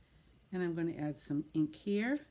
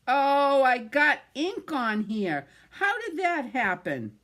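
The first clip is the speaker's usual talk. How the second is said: louder, slow, higher surprised oh; startled dismay; 6 semitones higher, 6 dB louder, slower than usual. Real, not acted. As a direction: catch the ink spot with alarm. Notices ink smudge on card.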